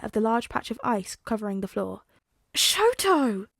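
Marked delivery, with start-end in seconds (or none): 2.5-3.5 sharp high cry of the name; exclaiming. A narrator's reading. Mother spots her son on the icy floor, exclaiming. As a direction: call out to her son in alarm. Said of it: "the former Missus Todoroki exclaims".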